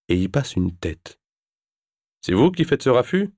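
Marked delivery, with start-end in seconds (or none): 2.2-3.3 much higher, annoyed complaint; complaining man. Acted. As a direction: grumble at the noise. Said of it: "se plaint-il". (il se plaint du raffut)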